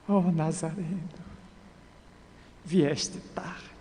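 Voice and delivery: softly voiced lament; dying lament. A narrator's reading. Dying woman to the Nazarene, in soft lament. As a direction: lament it weakly, with long gaps.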